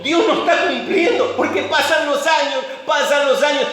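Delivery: high-pitched, impatient protest; mock exasperated protest at the delay; exasperated complaint. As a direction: complain like a believer tired of waiting. Acting out impatient believers' complaint.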